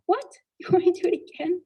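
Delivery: high, quick retold disbelief; incredulous. Wife asked by husband to repeat, laughingly incredulous. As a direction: question it with a laugh, pitch shooting up.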